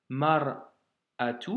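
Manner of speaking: mar'atu very slowly, with the glottal stop clear; slow down and mark the stop in mar'atu; slow detailed reading. Shown as a demonstration. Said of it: "Je détaille si tu veux". (mar'atu très lent, coup de glotte net)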